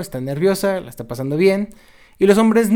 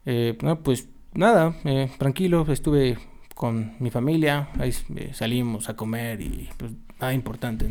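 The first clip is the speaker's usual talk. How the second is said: tono de hueva, bored; 2 semitones lower than usual. Shown as a demonstration. Answering a friend about the weekend, listless and lazy. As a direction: answer as if it's too much effort. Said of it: "es un tono de hueva".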